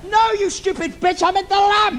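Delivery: high, loud exasperated yell; villain correcting the princess; exasperated shout. Acted. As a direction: snap at her in frustration.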